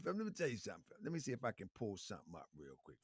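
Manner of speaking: normal talking voice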